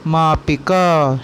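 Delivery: slow, syllable by syllable मापिका; मापिका धीरे-धीरे; slow teaching word. Shown as a demonstration. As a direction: spell out मापिका slowly for kids. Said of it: "उस scale को हम बोलते हैं".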